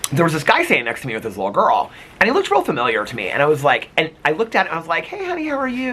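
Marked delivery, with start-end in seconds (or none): 5.0-5.9 high, soft, sing-song greeting; high soft kid-friendly hello; sweet voice for a child. Retold or imitated. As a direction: coo at a little girl. Greeting a little girl.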